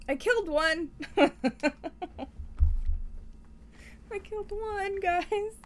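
gleeful brag; excited laughing. Real, not acted. Triumphant, laughing.

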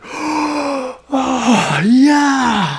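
high, drawn-out awestruck oh yeah; awed kids. Retold or imitated. Boys watching war film opening, high awe. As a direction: marvel like a kid at the opening. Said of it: "Me and my brother are like".

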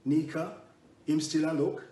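teasing Jamaican lilt; Jamaican accent. Retold or imitated. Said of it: "and said in a Jamaican accent".

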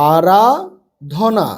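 আরাধনা very slow, split as আরা, ধনা; broken into parts. Shown as a demonstration. Teacher helping pupils with four-letter words, broken up slowly. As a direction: stretch আরাধনা out with a break inside. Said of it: "ভেঙে ভেঙে পড়বে".

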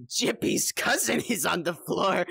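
giggling through the words; laughing clown. Acted. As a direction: crack up while pointing at the body. Clown laughs over body.

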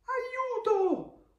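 very high, drawn cry for help; hammed-up panicked armadillo yelling for help; storybook scream. A narrator's reading. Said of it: "urla l'armadillo". (grido acuto dell'armadillo in pericolo)

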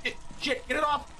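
high, frantic shrieks of terror; panicked screaming. Real, not acted. Monster on his head.

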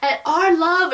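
high, hammed-up declaration; hammed-up love speech; mock lovestruck hero. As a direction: overact a lovesick vow, high. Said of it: "he was like".

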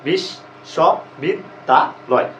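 বিশ্ববিদ্যালয় said as বিশ, স, বিদ, দা, লয় with pauses; syllable by syllable. Shown as a demonstration. Teacher breaks বিশ্ববিদ্যালয় into syllables for students. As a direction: count out বিশ্ববিদ্যালয় one syllable at a time. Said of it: "ভেঙে পড়ি আমরা".

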